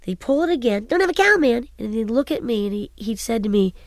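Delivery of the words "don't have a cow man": high, sped-up squeaky toy-doll Bart; Bart on helium. Retold or imitated. Mother recalls son's Bart doll, high helium Bart voice. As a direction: squeak it out like a talking doll with a short string. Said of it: "sorta sounded like Bart on helium".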